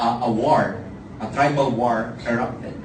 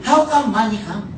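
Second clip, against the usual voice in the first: higher, slow broken English jab; mocking friends; 10 semitones higher than usual. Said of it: "they will tell me".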